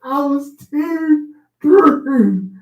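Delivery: high, slow words dissolving into laughter; too through, breaking up laughing; laughing hard. Real, not acted. Friend on the radio show cracking up over the joke.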